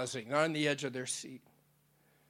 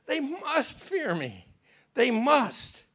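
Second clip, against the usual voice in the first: put-on childish; 8 semitones higher, 4 dB louder, slower than usual. A narrator's reading. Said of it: "His response sounded childish".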